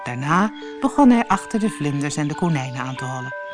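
ordinary delivery

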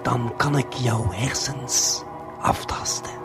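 ordinary delivery

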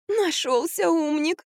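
high, slow drawn-out groan; moaning. A narrator's reading. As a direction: moan it sarcastically in a thin voice. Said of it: "простонала Марфа". (протяжный высокий стон)

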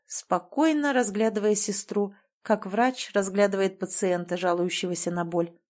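normal talking voice

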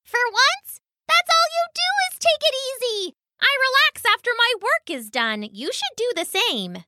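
very high, fast mouse call; calling over shoulder. Acted. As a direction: squeak it back while running. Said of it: "called over his shoulder".

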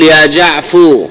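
slow يا جعفو ending on a clear u sound; drawn-out يا جعفو with the final u stressed; truncated vocative. Shown as a demonstration. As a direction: lesson demo of يا جعفو with damma on the last letter. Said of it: "فيبنيه على الضم".

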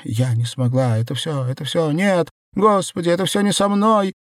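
high-pitched, panicky despair; distraught denial. Acted. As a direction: cry out as if refusing to believe it. Белка breaks down in denial.

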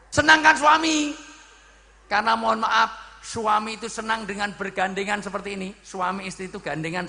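high, loud exhortation; full-force preaching. Real, not acted. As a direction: drive the point home to the whole congregation.

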